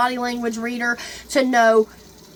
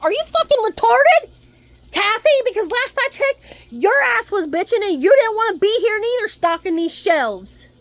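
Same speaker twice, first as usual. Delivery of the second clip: angry rant; 8 semitones higher than usual. Retold or imitated. Ranting at Kathy about not wanting to work, angry shout.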